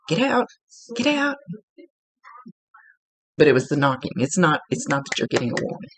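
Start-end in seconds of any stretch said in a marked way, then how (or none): 0.1-1.4 high, pushed get out; mock cop shout. Medium imitating the TV cop rescuing a man, high mock shout. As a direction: bark get out like a cop, pitched way up. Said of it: "the cop is going".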